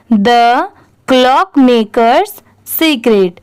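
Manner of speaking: very slow, word by word; read for repetition. Shown as a demonstration. Teacher reading aloud for students to repeat, very slowly. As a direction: model each word slowly, pausing for learners. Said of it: "इसमें आप भी मेरे बाद repeat करिए".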